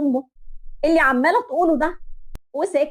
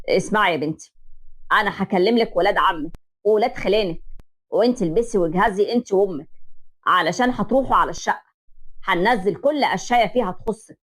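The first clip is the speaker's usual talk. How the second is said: lower, decisive father's voice; commanding father; 5 semitones lower than usual. Acted. Father instructing his daughter, low and decisive. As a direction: lay out the plan like orders.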